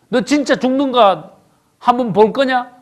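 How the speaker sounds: high-pitched scolding challenge; acted rebuke. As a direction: challenge them as the rebuking Lord. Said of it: "꾸짖는 거야". (높은 목소리로 꾸짖듯 다그치기)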